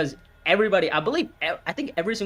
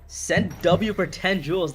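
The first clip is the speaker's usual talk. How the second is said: ordinary talk, nothing marked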